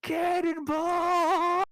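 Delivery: long, high stretched yell; yelling cannonball. Acted. Satorvik yells while jumping into the river, long high yell. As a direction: hold it out as you leap. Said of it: "runs out of the forest and yells".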